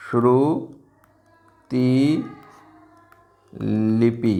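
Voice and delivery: Shruti stretched very slowly; drawn-out word. Shown as a demonstration. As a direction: drag out श्रुति लिपि syllable by syllable. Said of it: "इसका सही रूप कौन सा होगा". (श्रुति लिपि को बहुत धीरे खींचकर)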